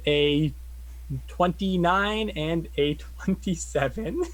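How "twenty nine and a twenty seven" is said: usual speaking voice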